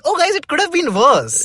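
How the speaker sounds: mocking textbook voice. Mocking the textbook telling kids it could be worse, sarcastic. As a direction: parody the book shrugging it off. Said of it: "normalizing what happened by saying".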